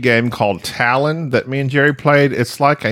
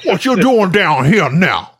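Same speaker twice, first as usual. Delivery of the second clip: hammed-up Cajun drawl, pitched up; Louisiana Cajun accent; 6 semitones higher, slower than usual. Host greeting a caller in a mock Cajun accent. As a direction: lay on a thick Louisiana accent. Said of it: "I get that accent from, uh, an S N L skit".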